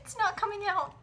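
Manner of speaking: squeaky high whine of panic; high-pitched whine. Real, not acted. Frustrated, flan stuck.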